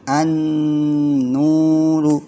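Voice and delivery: an-nuru with the nn held two beats, slow; held recitation. Shown as a demonstration. Teacher models reading for the class, held chant. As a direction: chant an-nuru holding the double n. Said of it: "harus dibaca panjang".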